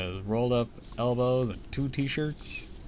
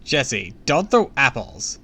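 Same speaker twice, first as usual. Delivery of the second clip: loud, raised warning; shouted scolding; 4 semitones higher, 9 dB louder, slower than usual. A narrator's reading. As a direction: call out a firm reprimand. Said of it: "Sabrina shouted".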